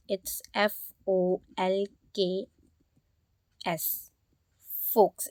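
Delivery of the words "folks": "folks" said as "fokes", no L; silent L. Shown as a demonstration. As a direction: drop the L in "folks", long O. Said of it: "एल होता है वो silent". ("folks" में L silent)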